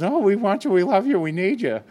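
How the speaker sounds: high, loud, gushing welcome; mock an eager welcome. Old-timers greeting a newcomer, gushing and bright. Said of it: "it was".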